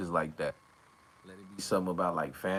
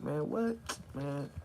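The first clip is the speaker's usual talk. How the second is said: mock butthurt; 9 semitones higher, much slower than usual. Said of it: "I'll get a little butthurt, like".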